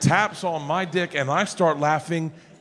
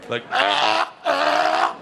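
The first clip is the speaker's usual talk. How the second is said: mock choking gasps; 9 semitones higher, much slower than usual. Imitating choking sounds.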